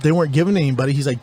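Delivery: ordinary delivery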